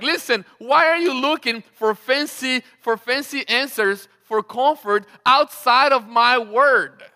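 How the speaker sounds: loud, forceful challenge as God; preacher acting out a booming reproach; imitated divine rebuke. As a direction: voice a loud, insistent scolding from above.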